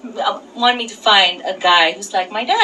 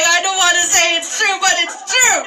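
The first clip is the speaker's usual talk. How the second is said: a hint of a higher, earnest local guy admitting it; 6 semitones higher than usual. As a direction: mildly imitate the vendor's friend confirming it. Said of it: "He's like".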